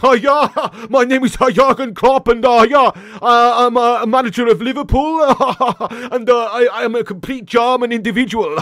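put-on loud, high German-accented manager; Jurgen Klopp. German manager introducing himself, loud laughing Jurgen Klopp impression. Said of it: "Lord PlayStation Klopp".

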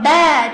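ba held long and pushed; stressed BA. Shown as a demonstration. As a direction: lean hard into a drawn-out ba. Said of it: "感觉到了ba这个音上面".

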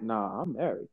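low, very soft smug imitation; mock his calm bragging; smug married friend. Friend mocked for bragging he's married, in a low, very soft smug voice. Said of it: "He was saying that like".

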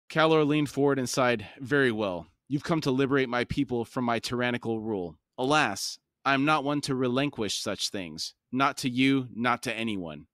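steady, measured audiobook reading; story narration. A narrator's reading. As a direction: read it like an epic fantasy audiobook. Reads fantasy novel aloud.

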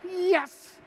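high, delighted repeated yes; gleeful yes. Retold or imitated. Pastor on the phone, hiding his glee. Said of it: "I was doing this".

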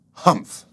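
loud scornful humph; grumpy humph. A narrator's reading. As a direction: snort it out like a grouchy camel. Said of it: "they would surely say".

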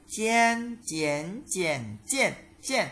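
"Chien, chien, chien, chien, chien" through the tones, high level first; high, rising, dipping, falling takes of "Chien, chien, chien, chien, chien"; tone drill. Shown as a demonstration. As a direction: recite "Chien, chien, chien, chien, chien" changing only the pitch contour. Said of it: "phần luyện tập về thanh điệu".

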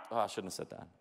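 usual speaking voice

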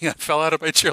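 loud, laughing disbelief. Real, not acted. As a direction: shout it through laughter. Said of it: "I literally lost it".

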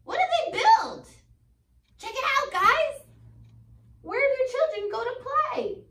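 bright teacher excitement; excited reveal. Real, not acted. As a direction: show them the big surprise. Teacher reveals picture to class.

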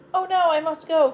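a startled, urgent cry; alarmed cry. A narrator's reading. Cinderella hears the midnight clock, alarmed cry. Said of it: "cried Cinderella".

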